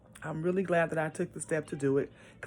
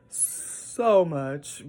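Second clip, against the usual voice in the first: laughed out, louder; laughing; 6 dB louder than usual. Real, not acted. Foster mom laughing at all she must learn. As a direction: laugh through the repeat.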